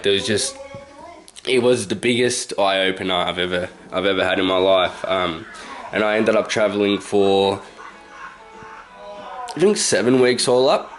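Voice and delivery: ordinary delivery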